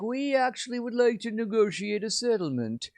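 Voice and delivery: high, smooth mock negotiator voice; mock diplomat. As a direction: play the reasonable envoy, lightly. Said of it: "Qatar is like".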